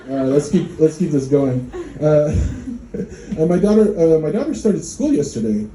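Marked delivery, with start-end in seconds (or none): none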